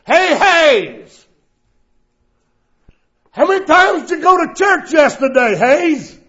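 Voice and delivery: loud, high mocking yell across the line; taunting coworker. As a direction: jeer at him like a rowdy coworker. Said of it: "started badgering, badgering".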